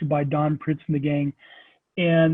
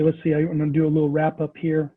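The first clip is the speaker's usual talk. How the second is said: ordinary delivery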